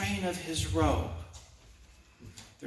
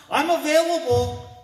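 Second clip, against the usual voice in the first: higher and louder, "available" lifted; eager offer; 8 semitones higher, 5 dB louder than usual. Acted. Preacher offering himself to the Lord, eager and lifted.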